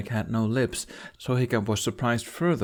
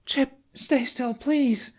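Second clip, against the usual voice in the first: much higher, slow pleading; desperate plea; much slower than usual. Acted. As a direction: beg the dragon to hold still. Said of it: "Astrid's desperation continued to mount".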